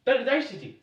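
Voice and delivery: high, loud startled cry; surprised exclamation. Acted. Friar startled by early visitor.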